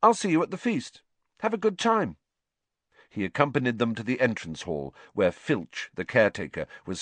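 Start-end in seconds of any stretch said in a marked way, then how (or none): 0.0-2.2 high-pitched, trying to sound breezy; put-on cheery nonchalance; would-be offhand. A narrator's reading. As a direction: feign a casual goodbye. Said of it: "what he hoped was an off-hand voice".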